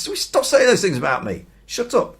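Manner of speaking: high, loud, furious snap at them; angry outburst. Acted. Peter cursing the crowd, a high, loud angry shout. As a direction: tell them to shut it, shouting them down. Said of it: "Peter starts swearing".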